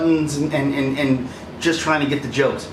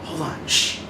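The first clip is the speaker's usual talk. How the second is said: hushed quote; 5 dB quieter than usual. Retold or imitated. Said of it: "he's like".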